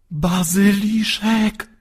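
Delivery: high, slow frightened cry; trembling gasp. A narrator's reading. Maciek gasps the monster's name, high and slow. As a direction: breathe out the name in terror. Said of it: "szepnął drżącym głosem Maciek".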